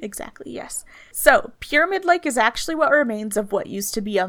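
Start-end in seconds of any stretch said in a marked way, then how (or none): 0.0-0.8 whispered agreement. Real, not acted. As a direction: breathe it out. Unvoiced reply.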